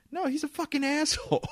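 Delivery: high, strained mock exclamation; exasperated outburst. Telling Gabby aside about the lawyer, exasperated.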